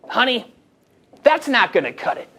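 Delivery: put-on Long Island woman's voice; Long Island accent. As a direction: imitate a blunt Long Island saleswoman. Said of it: "thick Long Island accent".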